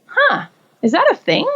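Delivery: high, amazed little question; retold surprise at a new idea; surprised. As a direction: sound caught off guard.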